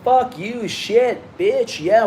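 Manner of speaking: mock cussing. Showing off his off-camera cussing self. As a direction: toss off curses like a rough guy. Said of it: "I'll cuss. I'll be like".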